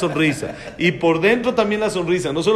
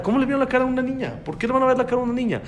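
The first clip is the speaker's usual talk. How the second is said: indignant; 7 semitones higher, much faster than usual. Acted. Indignant rant about cheated girl.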